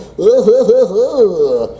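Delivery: real laugh after the bit. Real, not acted. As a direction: burst of laughter.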